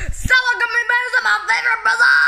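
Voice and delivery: excited. Acted. Excited fast exclamation.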